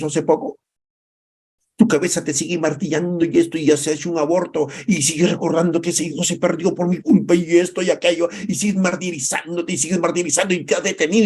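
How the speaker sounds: impassioned high preaching, rising to a cry; fiery pastor building to a peak. Acted. Preaching at full force.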